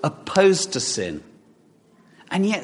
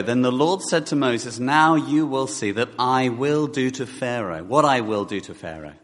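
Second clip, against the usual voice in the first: scripture reading; 4 semitones lower than usual. Acted. Reads scripture aloud.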